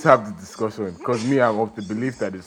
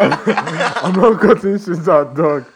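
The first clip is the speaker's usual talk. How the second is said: slow, laughing higher; 8 semitones higher, 5 dB louder, much slower than usual. Real, not acted. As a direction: laugh through the refusal. Laughing refusal.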